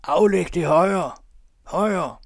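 high, loud, slow heckling call; drawn-out, full-voiced crowd shout. A narrator's reading. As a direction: holler it from the back of the hall. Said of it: "Råb".

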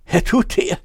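can't believe who's standing there; astonished. A narrator's reading. Sailor astonished to see his friend return.

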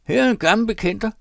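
high, loud, fast jeering scream; husband screaming at his wife. A narrator's reading. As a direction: yell it out to mock her. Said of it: "skreg han".

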